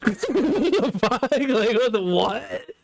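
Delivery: high-pitched, baffled reaction; laughing, can't believe it; real incredulous disbelief. Real, not acted. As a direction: sputter it in amused bewilderment. Stunned reaction amid laughter.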